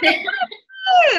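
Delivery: very high, slow, stretched party yell; excited shout. Retold or imitated. Coach hyping herself up for Thursday, shouting excitedly. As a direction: belt it like the weekend starts now. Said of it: "I get so excited".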